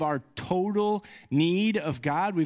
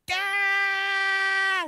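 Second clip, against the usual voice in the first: put-on sudden high shrill toddler wail, much louder; imitated toddler scream. Dad imitating his son's piercing high scream. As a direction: mimic a son's ear-piercing scream at full pitch. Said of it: "like, so loud, so high".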